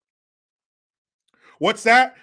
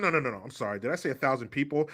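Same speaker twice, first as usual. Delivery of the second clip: quiet fake sorry; soft mock apology; mock apologetic; 6 semitones lower, 8 dB quieter, much faster than usual.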